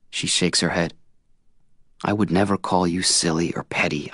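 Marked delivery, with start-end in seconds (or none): none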